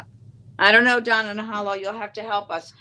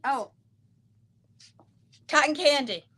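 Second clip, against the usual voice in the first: ordinary delivery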